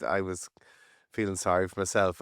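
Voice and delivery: ordinary delivery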